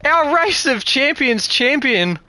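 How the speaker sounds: high, loud, amazed; breathless excitement. Real, not acted. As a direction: still buzzing after the win. After the finish, still buzzing.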